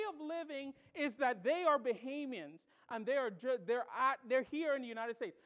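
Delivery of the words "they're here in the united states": ordinary talk, nothing marked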